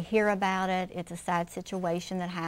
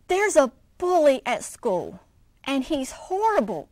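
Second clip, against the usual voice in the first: louder upset cub crying out; scared cry; 5 semitones higher, 6 dB louder than usual. A narrator's reading. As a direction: blurt it out in alarm. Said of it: "cried Ronnie".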